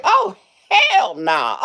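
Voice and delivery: slow, laughing oh hell nah; laughing disgust. Retold or imitated. Cashier recoiling from a man's breath, slowly laughing. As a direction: drag it out through a laugh. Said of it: "I was like".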